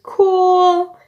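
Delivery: loud high drawn-out mock cool; a hammed-up satisfied cool. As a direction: savour the word cool. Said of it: "I'm like".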